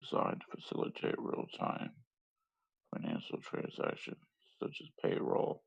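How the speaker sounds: soft whispered reading; whispering. Real, not acted. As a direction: whisper it under your breath. Reads crypto blurb unvoiced.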